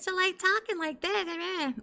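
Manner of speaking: very high-pitched, drawn-out imitation; mock high voice. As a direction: mimic his voice shooting way up. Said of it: "His voice got higher".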